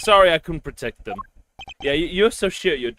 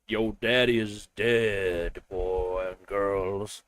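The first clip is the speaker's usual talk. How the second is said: solemn bearer of bad news; 9 semitones lower, 6 dB quieter, much slower than usual. Solemnly breaking news to children.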